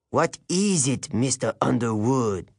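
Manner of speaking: put-on disdainful French-accented teacher; detesting. A narrator's reading. Teacher questions the student Grover, with open dislike. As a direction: let the dislike show in the question. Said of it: "His tone made it clear that he detested Grover".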